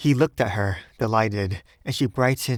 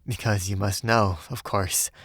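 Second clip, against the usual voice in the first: usual speaking voice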